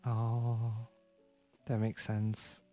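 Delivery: usual speaking voice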